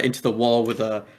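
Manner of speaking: usual speaking voice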